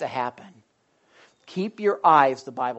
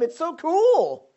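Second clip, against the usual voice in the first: usual speaking voice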